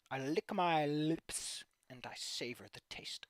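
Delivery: slow, soft whispery relish; savoring. Real, not acted. Streamer relishing the one fun fic, savoring. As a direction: draw the words out as if tasting them.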